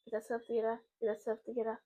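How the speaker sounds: quiet, low-key mantra to oneself; urgent self-talk. Retold or imitated. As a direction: repeat it softly under your breath, like steadying yourself. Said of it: "to myself".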